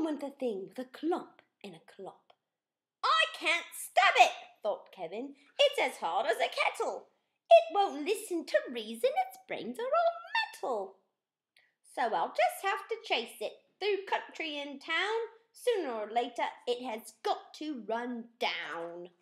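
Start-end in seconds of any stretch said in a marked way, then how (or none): 3.0-4.3 put-on higher, louder boyish knight; a keen little knight announcing himself; bright, bold young knight voice; eager young knight. Kevin's thoughts, high. 5.6-19.1 put-on higher, louder boyish knight; a keen little knight announcing himself; bright, bold young knight voice; eager young knight. Kevin reasons to himself.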